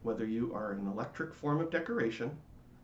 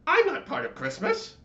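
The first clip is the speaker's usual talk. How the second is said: high, loud indignant protest; crying out; 9 dB louder than usual. A narrator's reading. The latke insists to the candy cane, loud high cry. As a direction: cry it out, offended and shrill with pride. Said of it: "cried the Latke".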